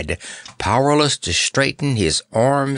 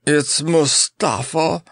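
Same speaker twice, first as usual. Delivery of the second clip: groaning; 6 semitones higher, slower than usual. A narrator's reading. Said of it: "groaned Notta".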